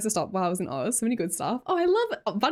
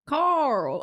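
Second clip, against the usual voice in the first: put-on higher, drawled hillbilly accent; attempted hillbilly accent; 6 semitones higher than usual. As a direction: try a hillbilly twang on the name. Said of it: "What's hillbilly?".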